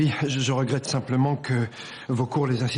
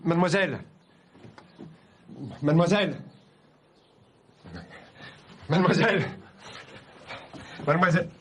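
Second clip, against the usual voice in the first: raised voice, insistent repeated call; calling through door; 3 semitones higher than usual. Acted. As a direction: call out to someone shut away. Calling through a closed bathroom door. (appel insistant à travers la porte)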